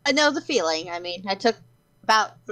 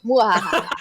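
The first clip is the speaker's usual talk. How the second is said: sneering panto villain laugh; 3 semitones lower, 4 dB louder than usual. Pretend. Villain laugh after challenge joke.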